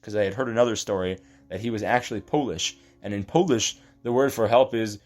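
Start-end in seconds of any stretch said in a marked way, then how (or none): none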